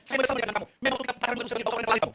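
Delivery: loud, high-pitched bitter declaration; hammed-up angry regret; acted marital outburst. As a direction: throw it at your spouse in a mock row. Acts spouse's regret in a quarrel.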